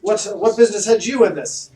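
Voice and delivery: high, loud haughty noble voice; pompous mayor. Acted. Mayor accusing the party of ruining his silk, haughty and loud. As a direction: look down your nose at them. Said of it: "He's like".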